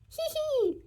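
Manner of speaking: very high giggle; ticklish giggle. A narrator's reading. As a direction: giggle helplessly as the pig sniffs you. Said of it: "the little man said".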